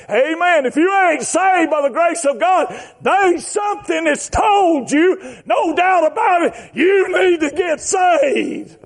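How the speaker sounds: high, slow, urgent exhortation; full-force preaching. Real, not acted.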